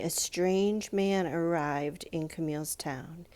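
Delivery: ordinary delivery, nothing marked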